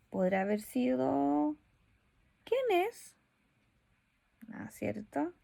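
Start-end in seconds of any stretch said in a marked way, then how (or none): 2.4-3.1 high, asking voice calling through the door. Acted. Teacher voicing someone answering a knock, high. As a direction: ask who's there from inside a room.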